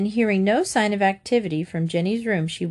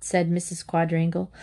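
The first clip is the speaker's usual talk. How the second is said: plain reading voice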